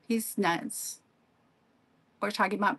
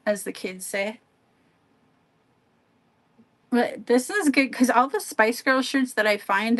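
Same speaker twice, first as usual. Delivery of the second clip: usual speaking voice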